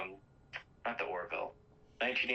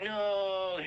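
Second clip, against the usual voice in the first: high, drawn-out "no"; mock anguished cry; 11 semitones higher, 3 dB louder than usual. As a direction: imitate a victim crying out in despair. Victim reacts to Jonathan's death, retold.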